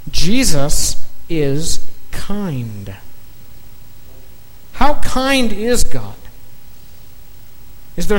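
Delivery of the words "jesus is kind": slow. Real, not acted. Slow climactic statement.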